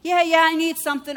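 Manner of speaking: eager stranger. Retold or imitated. Woman asking the preacher for help, high and eager. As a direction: insist you need help. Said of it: "woman's reply".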